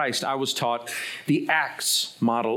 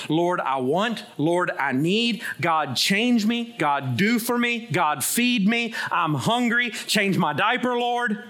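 higher, insistent mock baby demands; demanding infant; 6 semitones higher, slower than usual. Preacher mimicking childish prayers to God, demanding infant. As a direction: reel off needy pleas like a hungry infant. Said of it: "An infant".

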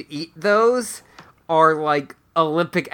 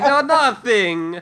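slow mock innocent denial; 6 semitones higher, slower than usual. Acted quote, innocent denial.

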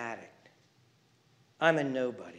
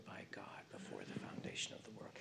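sudden whisper; 8 semitones lower, 22 dB quieter than usual. Real, not acted. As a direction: drop to a hushed whisper. Drops to whisper mid-sentence.

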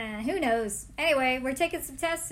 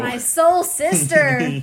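mock dramatic; 3 semitones higher, 12 dB louder, much slower than usual. Joking dramatic aside.

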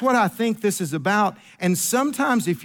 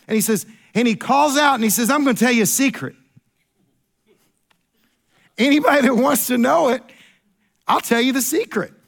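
preaching at full force; 4 semitones higher than usual. Acted. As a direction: call it out like a plea to the crowd. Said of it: "you stop yelling".

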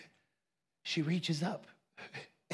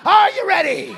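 high, loud, dragged ready call; imitating a preacher's shout; Alan's preacher call; 12 semitones higher, 12 dB louder than usual. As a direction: fire up the crowd like Alan. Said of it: "That's how Alan did".

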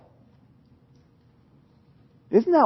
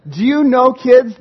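ordinary talk

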